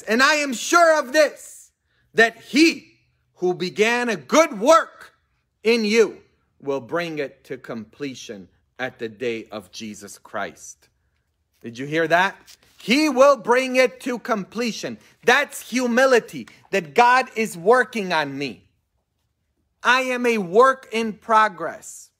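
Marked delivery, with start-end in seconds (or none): 0.0-10.9 loud, slow scripture reading; preaching. Acted. 12.8-22.1 loud, high preaching voice. Real, not acted. Preaching at full force.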